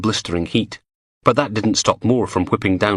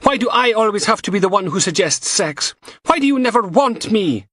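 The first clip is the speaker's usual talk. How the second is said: higher, pleading, hurt voice; pushing back at him in a quarrel, hurt; upset girlfriend; 13 semitones higher than usual. A narrator's reading. Samantha pleading in argument.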